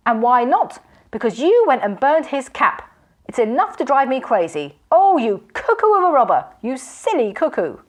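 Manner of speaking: high, loud furious name-calling; exasperated rant. A narrator's reading. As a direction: berate the robber in exasperation. Magician berating robber.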